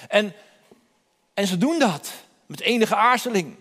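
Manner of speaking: normal talking voice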